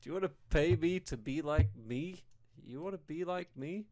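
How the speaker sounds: high, hammed-up sales pitch; cheesy advert voice. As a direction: overblown gym ad pitch.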